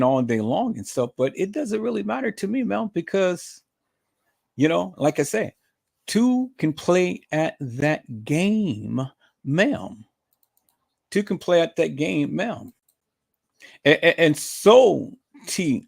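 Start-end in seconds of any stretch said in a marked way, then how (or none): 6.1-10.0 very slow, deliberate taunt; drawn-out taunt. Real, not acted. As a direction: stretch every word out to taunt her. Drawn-out warning to the rival.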